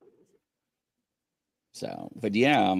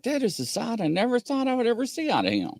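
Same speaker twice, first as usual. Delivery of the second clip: high and quick shocked aside; stunned disbelief; 10 semitones higher, faster than usual. Retold or imitated. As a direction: blurt out your shock. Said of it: "I was like".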